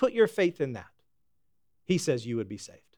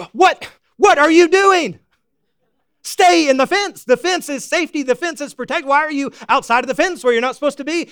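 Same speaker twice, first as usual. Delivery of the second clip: loud, high exasperated scolding; hammed-up angry rancher at the fence; mock scolding an animal; 9 semitones higher, 7 dB louder than usual. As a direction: yell at the runaway like a fed-up farmer. Scolds runaway animal in retelling.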